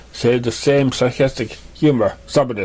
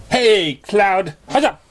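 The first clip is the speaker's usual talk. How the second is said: much higher, bright-voiced greeting; cheerful mare voice; 10 semitones higher, much slower than usual. Raindrops greets Cloud, much higher and cheerful. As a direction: call out breezily to a friend.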